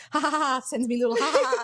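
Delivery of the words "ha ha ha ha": mock ha-ha of friends replying; imitated laughter. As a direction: act out everyone's laughing replies. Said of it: "and then everyone".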